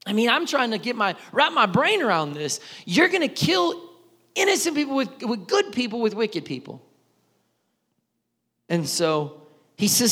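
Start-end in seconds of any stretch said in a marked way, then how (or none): 2.8-6.8 rising disbelief, high and loud; can't believe what he's hearing; incredulous. Real, not acted. Preacher aghast at God's plan, incredulous.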